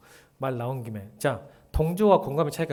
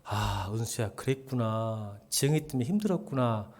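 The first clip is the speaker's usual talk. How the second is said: empathetic; 5 semitones lower, 7 dB quieter than usual. Shown as a demonstration. Pastor softly comforting a struggling church member. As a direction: gently acknowledge her pain. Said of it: "공감할게요".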